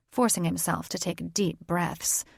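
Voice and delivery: plain reading voice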